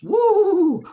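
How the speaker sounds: sudden very high, loud woo; mock whoop. Mocking doctors spooked by lost profits, high loud woo. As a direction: shriek the woo as if alarmed.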